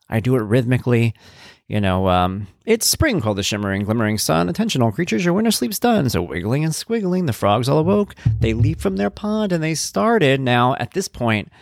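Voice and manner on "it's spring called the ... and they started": high, rhythmic storybook voice; high-pitched reading. Shown as a demonstration. Father reading a frog book to his son in a high, rhythmic voice. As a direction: read it high and sing-song to a child. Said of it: "I read it to him in this high-pitched voice".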